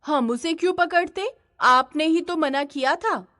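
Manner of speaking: high-pitched protest in a skit; protesting bystander. Acted. Bystander defending themselves to the man, high protesting voice.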